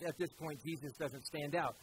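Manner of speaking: ordinary delivery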